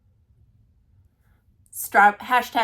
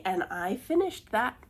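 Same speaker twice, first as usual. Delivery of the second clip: ordinary talk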